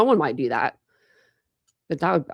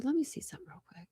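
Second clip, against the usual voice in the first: fast, higher whispered aside; quick whisper; 8 semitones higher, 13 dB quieter, faster than usual. Real, not acted. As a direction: mutter it quickly to yourself in a whisper. Whispering aside while searching online.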